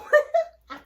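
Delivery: very high, loud shrieking laughter. Real, not acted. Cracking up at her own joke, shrieking laugh. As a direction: let out a piercing cackle.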